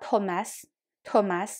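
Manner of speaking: English "Thomas" with aspirated t and final s; "Thomas" as tho-mas, final s sounded; English pronunciation. Shown as a demonstration. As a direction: say "โทมัส" the English way, puff on the t. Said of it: "ถ้าเป็นภาษาอังกฤษ เราต้องออกเสียงว่า".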